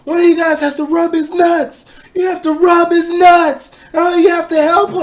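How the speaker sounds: very high, loud screaming at full force; drunken screaming. Retold or imitated. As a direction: scream it at the top of your lungs. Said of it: "I'm screaming almost at the top of my lungs".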